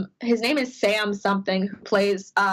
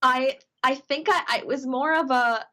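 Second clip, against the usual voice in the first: normal talking voice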